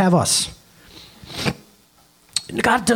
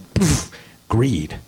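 dismissive scoff; 6 semitones lower than usual. Real, not acted. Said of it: "we don't talk about that".

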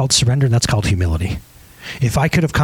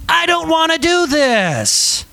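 slow pleading; 11 semitones higher, much slower than usual. Retold or imitated. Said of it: "he shows us in the Garden".

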